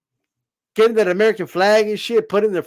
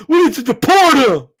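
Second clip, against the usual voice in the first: higher, louder hammed-up call to deport; mock patriot demand; 6 semitones higher, 5 dB louder than usual. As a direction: bark it like a flag-waving fan. Voicing patriot fan.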